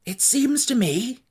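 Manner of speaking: slow and high, a lightly voiced straw man musing; the Scarecrow thinking it over aloud. A narrator's reading. Scarecrow to his friends in council, slow high musing.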